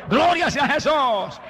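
drawn-out praise, x0.5 slow; slow fervent acclamation. Real, not acted. Preacher calling out praise to the congregation, slow and fervent. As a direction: stretch each word in praise.

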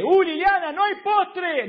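high, loud mock whine at the wife; whiny husband. Newlywed husband calling to his wife about no dessert, high and whiny. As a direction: complain like a spoiled newlywed. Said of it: "abrí la heladera y dije".

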